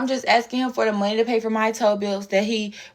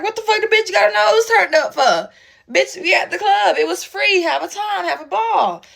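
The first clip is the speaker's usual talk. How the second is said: high, loud exasperated rant; fed up with her attitude; 9 semitones higher, 4 dB louder than usual. Retold or imitated. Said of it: "And I'm like".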